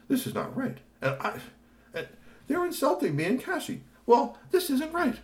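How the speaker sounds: high indignant complaint; high upset Tony voice. Acted. Tony upset about being insulted, indignant. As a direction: protest the insult. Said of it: "Tony was not happy".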